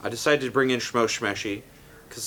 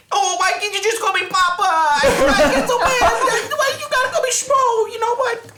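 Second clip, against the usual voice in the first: much higher, whiny cartoon dad; whiny Shmo Shmeshi; 5 dB louder than usual. Father upset at being called Shmo, high and whiny. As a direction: squeal out the hurt papa. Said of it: "Shmo Shmeshi".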